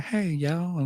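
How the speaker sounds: sweet little touch of Southern twang; light Southern twang. Retold or imitated. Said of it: "Having that twang".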